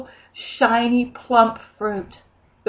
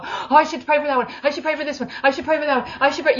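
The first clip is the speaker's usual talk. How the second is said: higher, fretful mock obligation; nagging yourself with duty; 6 semitones higher, faster than usual. Mocking believers in the marketplace, fretful duty. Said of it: "go to the marketplace and go".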